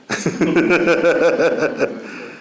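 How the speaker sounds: high chuckle in a long run; laugh. Real, not acted. Speaker after admitting whom they pray for, chuckling. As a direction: chuckle at your own admission.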